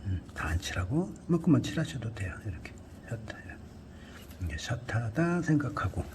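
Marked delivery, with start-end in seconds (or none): 2.5-3.6 half-whispered aside while painting; murmured to self. Real, not acted. Art tutor murmuring to himself over the canvas, half-whispered. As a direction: mutter under the breath while working the brush.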